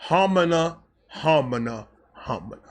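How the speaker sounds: cartoon flustered homina fading out; stammering awe. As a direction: trail off in dazed homina homina. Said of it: "And I went".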